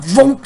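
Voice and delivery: loud high engine vroom; vroom noise. Retold or imitated. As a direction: zoom sound for a speeding dog. Dog rushing back.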